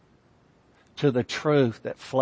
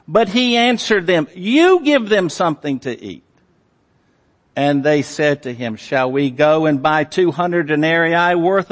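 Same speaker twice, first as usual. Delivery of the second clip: loud, raised-pitch scripture reading; booming sermon reading; preaching; 4 semitones higher, 5 dB louder than usual. Acted. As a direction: read it out from the pulpit with full force. Preacher reads and expounds loudly.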